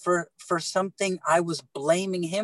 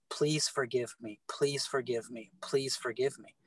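softer, hushed plea repeated three times; soft pleading; 3 semitones lower, 8 dB quieter than usual. Retold or imitated. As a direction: quietly beg forgiveness, as if weeping. Jesus weeping, asking forgiveness.

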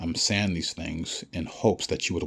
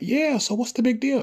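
very high, louder, squeaky mocking voice; squeaky cartoon official; 5 dB louder than usual. Vlogger mimics officials shrugging off the public, very high squeaky mock voice. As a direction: squeal it like a smug cartoon bureaucrat.

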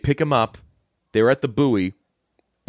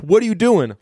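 shocked; 9 semitones higher, 3 dB louder than usual. Retold or imitated. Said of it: "And Carlito's like".

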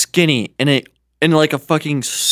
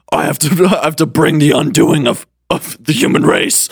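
put-on growly devil voice; Satan's demon voice. Satan explaining his mission to the dreamer, demon voice. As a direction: intone it like a cartoon devil. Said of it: "in a deep voice".

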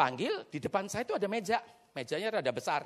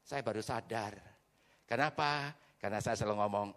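ordinary delivery

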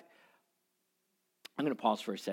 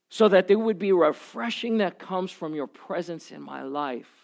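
earnest prayer; 4 semitones higher, 4 dB louder than usual. Acted. Prayer voiced in sermon.

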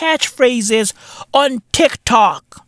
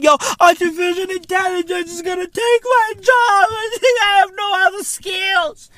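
high mocking voice of a scared worker, laughing; mock whiny worker; 8 semitones higher than usual. Mocking people who fear AI with a high whine. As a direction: ham up a panicked office drone.

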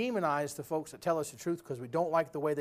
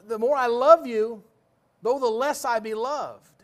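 quoted scripture, loud; 7 semitones higher, 11 dB louder, slower than usual. Retold or imitated. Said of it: "he says".

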